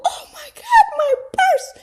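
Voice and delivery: high, loud laughing shriek; panicked outcry. Retold or imitated. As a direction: yelp it in mock panic. Retold panic over lost purse.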